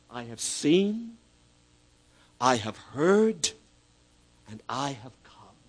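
slow, measured divine words; each phrase slow, landing down; solemn slow reading. Acted. God's words read slowly.

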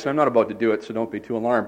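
usual speaking voice

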